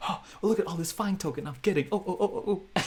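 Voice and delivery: high, fast gleeful voice; greedy glee. Retold or imitated. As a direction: gloat over the tokens. Greedy buying like a ninja.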